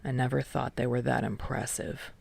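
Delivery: low, soft dismissive mutter. A narrator's reading. Kei dismissing the seniors to friends, low mutter. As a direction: mutter it under your breath. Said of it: "Kei mutters".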